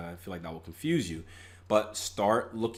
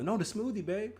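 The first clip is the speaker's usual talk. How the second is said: high mock innocent excuse; 12 semitones higher, 3 dB quieter than usual. Acted excuse to date.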